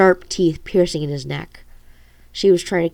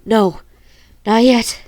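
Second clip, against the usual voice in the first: out of breath; 4 semitones higher, 5 dB louder, slower than usual. Acted. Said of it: "she said out of breath".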